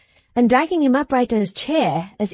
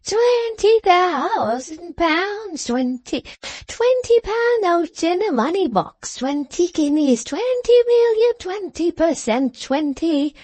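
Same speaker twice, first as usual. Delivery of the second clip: high-pitched crone gabbling in fits, vowels stretched; jabbering old woman; 9 semitones higher, slower than usual. Acted. Old wife woken from dozing by the fire, jabbering in high excitement. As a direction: squawk it high like a startled, muddled old wife. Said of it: "is instantly aroused and jabbers".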